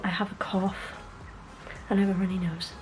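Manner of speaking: ordinary delivery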